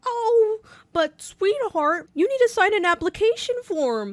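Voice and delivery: high, sugary condescending voice; sugary fake-sweet lady. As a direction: talk down sweetly like to a child. Woman voiced sweetly in story.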